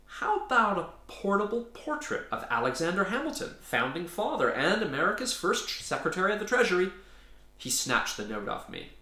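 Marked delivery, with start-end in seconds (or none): 0.1-6.9 eager pitch. Acted. Hurried sales pitch, high, fast.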